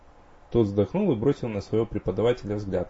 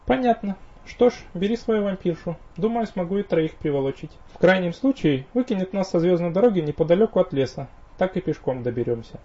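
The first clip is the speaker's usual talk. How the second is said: high, breezy elf woman; playful elf wife; 9 semitones higher than usual. Acted. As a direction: answer lightly in a high elf voice.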